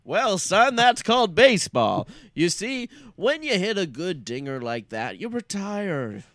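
hearty, loud folksy father; folksy old sitcom dad. Acted. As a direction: explain it like a corny TV dad. Father explains baseball to son.

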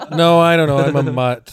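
ordinary talk, nothing marked

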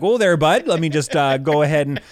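high, loud, eager offer to help; helpful Canadian. Acted. Canadian arm offering to fetch things for an astronaut, eager and helpful. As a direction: jump in as an over-helpful Canadian.

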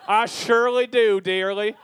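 Newfoundland accent attempt. Shown as a demonstration. Host persisting with his demo, bad Newfoundland accent. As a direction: lay on a Muppet-like Newfoundland accent. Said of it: "Do the Newfoundland accent".

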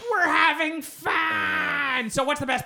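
high, slow, loud shout; mock shout. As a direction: belt it out in a hammed-up way. Sudden exaggerated shout.

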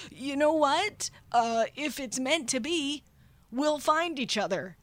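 hammed-up panicky goodbye; hurried mock farewell. She puts off the man asking for her number, high mock-panicked farewell. Panicked excuse while fleeing him.

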